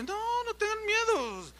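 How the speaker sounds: high, slow, gentle reassurance; calm their fear as you answer; reassuring pastor. Retold or imitated. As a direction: pastor reassures fearful church leaders, soothing. Pastor calms fearful church.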